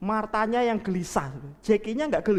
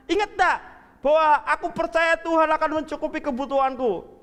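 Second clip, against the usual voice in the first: excited; 7 semitones higher, 3 dB louder than usual. Acted. Jackie calls sister with news.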